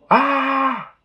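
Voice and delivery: very high playful shriek; scream of fright. Acted. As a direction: shriek in mock terror. Children scream.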